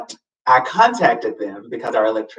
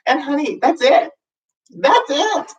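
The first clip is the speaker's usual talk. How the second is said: much higher, sassy and final; 12 semitones higher than usual. Acted. Vlogger laying down her rule, high sassy finish. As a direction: toss it off with sass.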